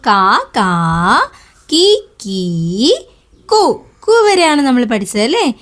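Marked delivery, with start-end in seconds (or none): 0.1-2.9 very slow, each syllable drawn out, short then long; slow letter recap. Shown as a demonstration. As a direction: recite ക കാ കി കീ for children, slowly. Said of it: "എത്ര അക്ഷരങ്ങൾ നമ്മൾ പഠിച്ചു". (അക്ഷരങ്ങൾ പതുക്കെ നീട്ടി ചൊല്ലി)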